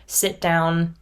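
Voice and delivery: ordinary talk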